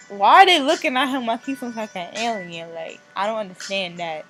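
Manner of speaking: usual speaking voice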